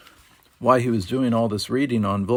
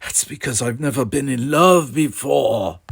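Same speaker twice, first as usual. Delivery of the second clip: mildly moaning, pitched-up protest; lovesick moan; 4 semitones higher, 4 dB louder than usual. A narrator's reading. Smitten Louis explains to his friend, moaning. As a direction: swell plaintively on the confession. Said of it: "moaned Louis".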